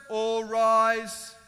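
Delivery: loud, drawn-out official call; court clerk's call. Acted. Clerk of court to the courtroom, loud formal call.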